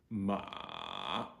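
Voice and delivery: MA sinking very low, then rising; low grumbly third tone. Shown as a demonstration. Teacher recaps Jameson tone, MA low and grumbly, rising at the end. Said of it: "Third tone is the low, grumbly".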